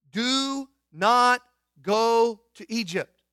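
slow, loud, leaning on NOT; stern command. Acted. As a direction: lay down the prohibition firmly. Voicing God's command.

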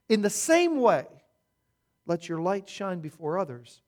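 measured, solemn Bible reading; scripture reading. Acted. Preacher reads the passage to the congregation, solemn reading. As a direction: read the verses as holy text.

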